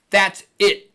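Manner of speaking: THAT'S IT, both words punched loud and high; pissed-off double stress. Shown as a demonstration. Teacher showing a tougher sound, "That's it" stressed hard on both words. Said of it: "you're pissed off".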